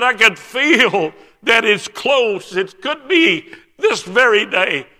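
imitated tearful hope, high and slow; crying. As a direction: draw it out loudly, overwhelmed by expectation. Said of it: "And he's crying now".

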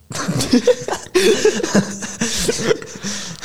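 high-pitched burst of laughter; real laughter. Real, not acted. Friends hear the light bulb joke, real laughter. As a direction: laugh helplessly at a silly joke.

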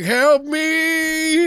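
high, hammed-up drawn-out wail; mock cry for help. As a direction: play up a helpless plea for rescue. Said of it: "shouted out for you".

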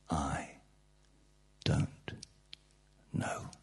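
a whispered, unsure aside; mock whisper. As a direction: breathe it out like a hesitant secret. Quoted self-doubt, whispered.

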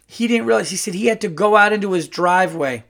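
ordinary delivery

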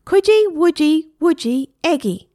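high, loud parrot squawk; a parrot reciting its new phrase, high and loud. A narrator's reading. Parrot finally says phrase.

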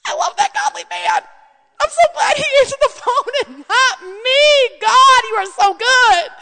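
wife praising her calm husband; gushing relief. Retold or imitated. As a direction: swoon with thankful delight. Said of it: "And I was like".

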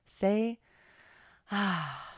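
ordinary talk, nothing marked

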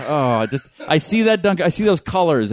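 ordinary talk, nothing marked

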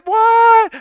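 high, loud, drawn-out exclamation; amazed cheer. Retold or imitated. Parents watching baby take a step, high loud exclamation. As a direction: retell the parents' whoop at the steps. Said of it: "And we said".

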